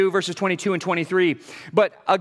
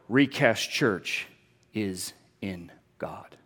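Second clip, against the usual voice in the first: hushed solemn declaration; 10 semitones lower, 7 dB quieter, slower than usual. Real, not acted.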